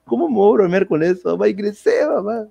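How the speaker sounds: high, pleading retold cry; re-enacted emotional protest; tearful insistence. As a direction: insist to your mother, mock-tearful. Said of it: "Yo estaba llorando también".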